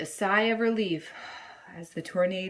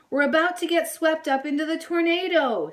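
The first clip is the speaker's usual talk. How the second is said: higher, louder thrilled shout; excited yell; 7 semitones higher, 5 dB louder, faster than usual. A narrator's reading. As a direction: shout as the twister hits. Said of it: "Sadie yelled".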